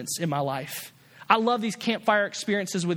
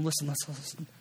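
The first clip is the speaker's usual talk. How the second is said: soft, hushed call fading away; still small voice; 5 semitones lower, 9 dB quieter, faster than usual. Acted. God hushes Elijah in a soft voice. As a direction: draw him close with a hush. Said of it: "still, small voice".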